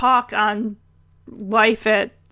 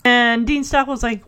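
ordinary talk

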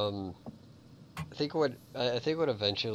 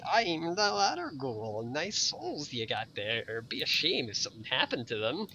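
ladder ghoul voice; 6 semitones higher than usual. Retold or imitated. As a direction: purr it like a smiling loan shark. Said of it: "my friend's voice out of my head".

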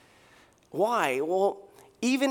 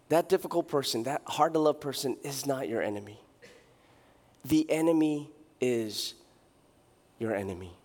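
solemn; 6 semitones lower, 5 dB quieter than usual. Real, not acted. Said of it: "sermon key point".

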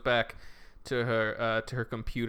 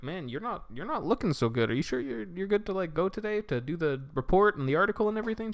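concerned boss; 9 semitones higher, faster than usual. Retold or imitated. Boss checks on tired employee.

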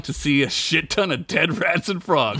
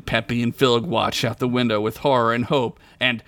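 usual speaking voice

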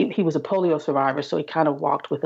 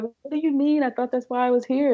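retold bewilderment, pitched high; puzzled protest; 9 semitones higher than usual. Patient questioning doctor about drinking, puzzled. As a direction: act out a confused newcomer's objection, voice raised in pitch.

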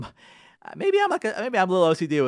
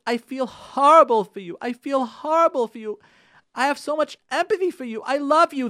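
loving plea; 7 semitones higher, 8 dB louder than usual. Retold or imitated. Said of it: "the message was the following".

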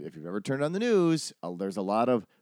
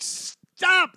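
a much higher, louder yelled stop; retold shout; 6 dB louder than usual. Site rep jumping in front of the camera, shouting. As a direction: leap out and yell it to halt the shot. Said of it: "And I'm like".

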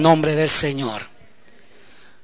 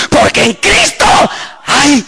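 full-force preaching; 6 semitones higher, 4 dB louder, slower than usual. Acted.

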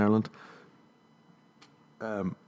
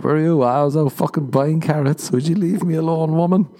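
mock defensive husband; 8 semitones higher than usual. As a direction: play the caught husband brushing her off. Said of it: "go home to the wife".

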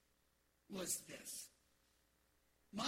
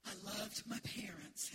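ordinary talk

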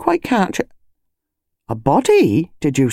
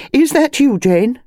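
much higher, louder anxious society lady; upset friend on the phone; agitated lady voice; 9 semitones higher, 3 dB louder than usual. Acted. Mrs Bantry calls urgently.

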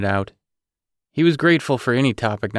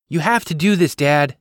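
higher and louder, urgent plea to Dad; eager insistence; 6 semitones higher, 5 dB louder than usual. A narrator's reading. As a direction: push him, excited and insistent. Daughter pleading eagerly with dad.

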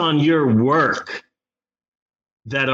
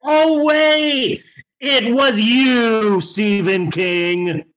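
mock surprise; 8 semitones higher, much slower than usual. Mock reveal insult to King.

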